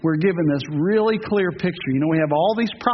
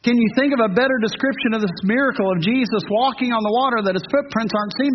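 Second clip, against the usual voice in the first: ordinary delivery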